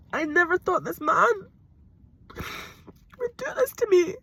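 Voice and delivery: very high, slow, breaking; wailing disbelief at his betrayal; crying. Real, not acted. Crying about partner's betrayal.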